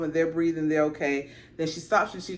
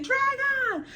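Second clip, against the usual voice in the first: high, crying alarm shouts; mock panicked screams; panicked crowd. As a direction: townsfolk screaming at the sky. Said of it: "all you hear are people saying".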